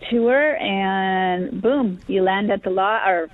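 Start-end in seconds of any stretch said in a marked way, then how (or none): none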